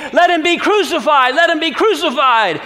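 top-of-voice high yell. Acted. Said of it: "they cried out all the more".

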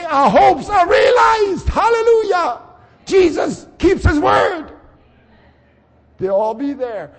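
slow, high, loud hallelujah shout; joyful shout. Pretend. The resurrected shouting praise to Jesus, slow and loud. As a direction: cry out in triumph. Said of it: "they're all gonna say".

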